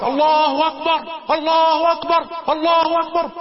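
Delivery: slow, high, loud takbir; army takbir. Retold or imitated. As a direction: proclaim it like a marching army. Whole army chanting takbir.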